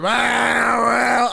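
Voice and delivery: loud, high mock gawking; mocking wow. Freeway drivers gawking at his tiny car, loud mock wow.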